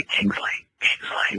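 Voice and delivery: breathy unvoiced whisper calling a name; ghostly whisper. A ghost in the attic whispering his name.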